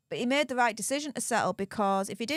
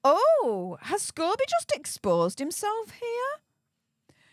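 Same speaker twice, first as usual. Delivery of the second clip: mock shocked gasp; 11 semitones higher, slower than usual. Mock shock at author's revelation.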